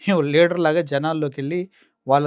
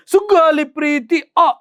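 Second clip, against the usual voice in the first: much higher, louder, slow wailing cry; drawn-out high mock slogan; mocking Pawan; 11 semitones higher, 8 dB louder, slower than usual. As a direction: parody a pompous campaign shout. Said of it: "అన్నారు".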